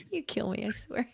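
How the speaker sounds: soft, high laughing through tears; can barely speak for laughing; breathless laughter. Real, not acted. Cohost cracking up at the joke, laughing softly.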